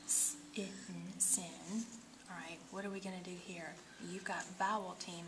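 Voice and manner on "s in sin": "s-, in, sin" split first, then blended; sound it out. Shown as a demonstration. Teacher sounding out a syllable for a student, split then blended. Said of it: "your first syllable is closed".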